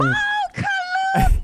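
very high, loud joyful shout of the name; joyful cry. Acted. Old woman spotting Kalugi, shrieking for joy. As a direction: shriek his name in delight. Joyful greeting of returned friend.

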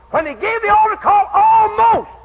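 high, loud revival cry; full-force preaching. Real, not acted. Preacher telling of the altar call, full-force preaching. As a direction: throw it out at full voice.

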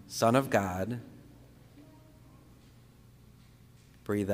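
slow, measured prayer with long pauses; meditative prayer leading, each phrase settling at the end; guided breathing prayer. Real, not acted. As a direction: guide them breath by breath, unhurried.

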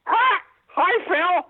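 high, chirpy talking-parrot voice. Acted. Parrot greeting the new lady of the house, high parrot voice. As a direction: play the brothel parrot greeting its new owner. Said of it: "the parrot sees him and says".

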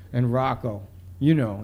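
usual speaking voice

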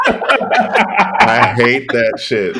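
slow, laughing groan. Real, not acted. Laughing at pun.

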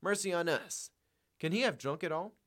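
appalled, high-pitched exclamation; crying out. A narrator's reading. Wife finding the money gone, crying out. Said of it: "she cried".

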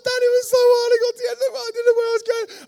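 put-on high, loud wailing child; hammed-up ugly crying to dad; mock bad cry. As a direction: blubber like a sobbing three-year-old. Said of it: "I'm doing the bad cry".

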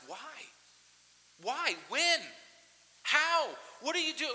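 loud, high, pleading questions hurled upward; anguished cry to God. Retold or imitated. Pastor voicing believers crying out to God, anguished loud pleading. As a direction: cry out why to God like a man at the end of himself.

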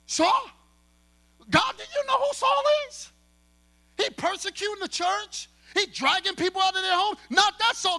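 high, loud, stunned "Saul?" disbelief; incredulous disbelief. Acted. Ananias protesting to God about Saul, disbelieving. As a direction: balk in shock at the order. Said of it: "So he goes".

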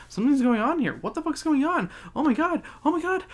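high, rising hammed-up alarm; exaggerated high-pitched fretting; mock panic. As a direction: work yourself into a fake frenzy. Acted panic over the plane.

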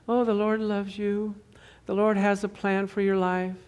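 soft, gently lilting Pollyanna; sweetly cheery church lady, lightly imitated. Church lady tells a worker about the Lord, Pollyanna voice. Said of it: "she had that Pollyanna voice".

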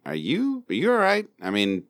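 ordinary delivery

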